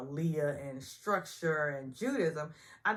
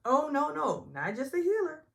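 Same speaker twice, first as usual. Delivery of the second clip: mock medium; 10 semitones higher, 6 dB louder than usual. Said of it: "She says".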